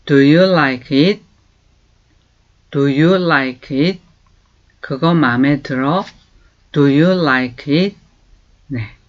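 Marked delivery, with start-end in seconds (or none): 0.1-4.0 very slow, clear English for learners to copy; slow model phrase. Shown as a demonstration. Teacher slowly modelling a question. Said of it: "이렇게 말하고요". 6.7-8.0 very slow, clear English for learners to copy; slow model phrase. Shown as a demonstration. Teacher slowly modelling a question. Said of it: "이렇게 말하고요".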